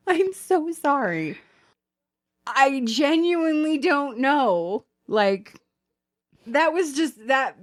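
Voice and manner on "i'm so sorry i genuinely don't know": slow, higher mock apology; mock sorry. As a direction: drag out a fake apology. Mocking the email's tone.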